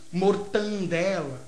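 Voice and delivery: "mortandela" with an added nasal n, said slowly; nasalized mispronunciation. Shown as a demonstration. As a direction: drag out "mortandela", nasal before the d. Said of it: "as nasalizações".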